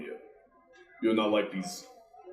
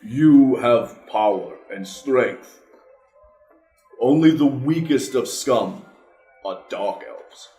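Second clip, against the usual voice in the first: stern; 3 semitones higher, 8 dB louder, slower than usual. Acted. Character lectures child sternly.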